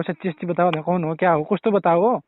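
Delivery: usual speaking voice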